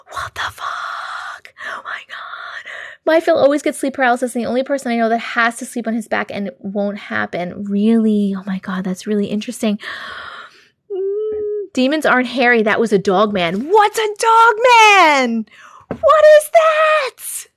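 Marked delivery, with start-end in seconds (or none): 0.1-2.7 slow, stunned whisper; horrified whisper. Real, not acted. Reader reacting to the demon's description, horrified whisper. 13.7-17.5 high, loud squeal of confusion; alarmed disbelief. Real, not acted. Reader learning demons may be dog men, alarmed disbelief.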